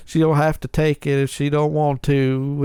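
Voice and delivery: lightly put-on slow Southern drawl; a hint of a bad Southern accent, slow; mock Nicolas Cage drawl. Host imitating Nicolas Cage's line in a lazy Southern accent. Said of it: "he's trying to be Droopy Dog".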